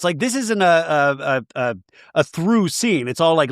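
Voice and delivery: normal talking voice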